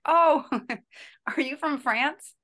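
high, slow, laughing question; hotel clerk, amused and puzzled; amused surprise. Retold or imitated. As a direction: tease like a desk clerk who can't place the accent. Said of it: "the lady was like".

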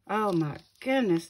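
ordinary talk

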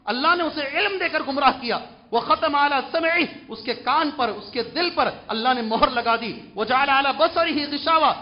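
forceful preaching. Acted. Preacher expounding a verse to the congregation, forceful preaching. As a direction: drive each phrase home from the pulpit.

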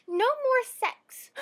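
put-on high, loud, drawn-out little-kid yell; child's outburst. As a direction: blurt it out slowly at full voice. Little girl yells at her brother.

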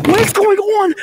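very high, loud panicked shriek; panicked cry. Real, not acted. Stranded caller demanding answers from a friend, panicked. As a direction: cry out in alarm, pitch way up.

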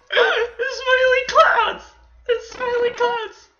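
very high, loud gushing squeal; delighted squeal. Real, not acted. Gamer spotting smiley clouds, delighted squeal. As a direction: squeal as if overcome by cuteness.

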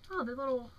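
ordinary delivery

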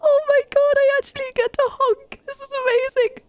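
high, delighted squeal; real excited squeal. Real, not acted. Player discovers she can honk, squealing with delight.

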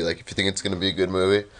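normal talking voice

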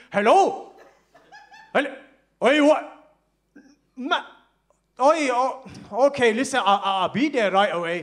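hammed-up fake call to escape; fake phone call. [name] fakes a phone call to leave, loud and hammed-up.

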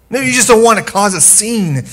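high, loud preaching push; rising passion. Real, not acted. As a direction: lift the congregation. Preaching climax.

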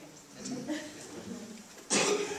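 a quick amused laugh; light laugh. Real, not acted. Panelist greeting the audience, light laugh.

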